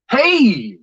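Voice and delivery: high, loud hey; excited shout. Real, not acted. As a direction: open the show with energy. Show intro shout.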